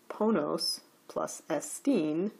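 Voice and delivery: slow "ponos plus estine", pitch up on "po" and on "-tine"; separate word accents. Shown as a demonstration. Teacher setting out the second rule's example, both words accented. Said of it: "enclitic will retain its accent".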